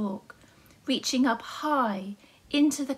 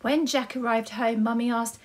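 plain reading voice

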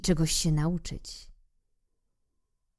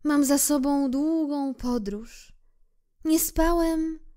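tired little prince; 9 semitones higher than usual. Acted.

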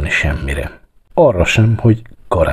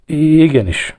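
high, stammered reply; nervous stammer; 9 semitones higher than usual. A narrator's reading. Clerk answering his raging boss, stammering. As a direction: stumble on the first sound, cowed. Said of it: "hebegte az írnok".

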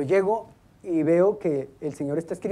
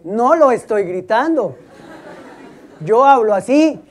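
mock loud protest; 4 semitones higher, 8 dB louder, slower than usual. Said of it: "Pero no me grite".

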